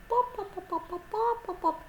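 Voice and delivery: high, rapid string of clucks; playful high hen imitation; hen clucking.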